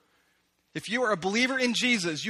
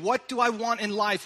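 usual speaking voice

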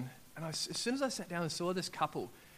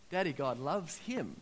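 ordinary talk, nothing marked

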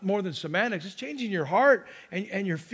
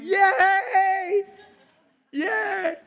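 much higher, joyful mock cheer; cheering God; 12 semitones higher, 3 dB louder than usual. Pastor voicing God cheering when you wake up, high joyful shout. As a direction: cheer for them like a proud parent.

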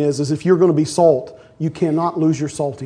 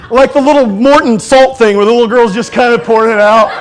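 laughing; 5 semitones higher, 8 dB louder than usual. Real, not acted.

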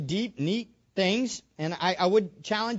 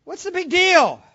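higher and louder, rallying the room; rousing exclamation; 9 semitones higher, 8 dB louder than usual. Real, not acted. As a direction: fire up the congregation. Preacher rouses the congregation.

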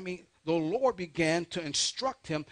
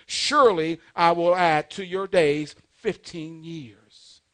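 slow, loud proclamation of God's word; scripture reading; 9 dB louder, slower than usual. Real, not acted. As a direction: proclaim it firmly, drawing out the key words. Reads God's message from scripture.

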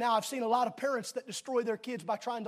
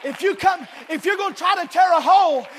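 fervent preacher's call, raised; preaching; 5 semitones higher, 10 dB louder than usual. Real, not acted. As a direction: preach it out with full force. Preaching at full force.

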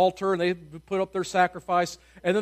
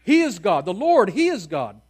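loud, higher acclamation of the crowd; crowd shout; 3 semitones higher, 10 dB louder than usual. Retold or imitated. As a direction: proclaim it out loud in triumph. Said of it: "they begin shouting".

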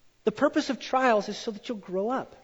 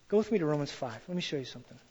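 ordinary talk

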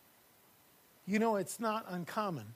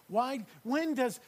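ordinary talk

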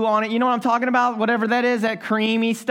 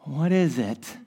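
low puzzled question; 7 semitones lower, 4 dB quieter, slower than usual. Retold or imitated. Puzzled by fuzzy ultrasound image.

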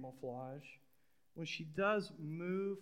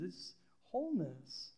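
usual speaking voice

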